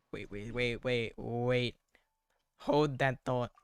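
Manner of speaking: ordinary talk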